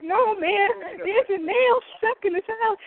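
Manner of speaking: high, loud disbelieving retort; incredulous. Retold or imitated. Refusing to serve salad with nail, loud disbelief. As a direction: protest like it's obviously absurd. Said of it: "I'm like".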